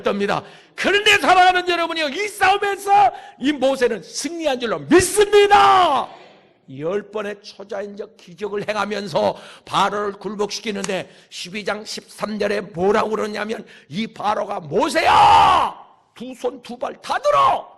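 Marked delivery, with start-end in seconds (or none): 0.8-6.1 high, loud exhortation; impassioned revival preacher; fervent preaching shout. Real, not acted. Preacher exhorts congregation at full force. 14.6-17.7 loud, drawn-out call to Moses; hammed-up shout with a stretched name. Acts out Pharaoh calling to Moses.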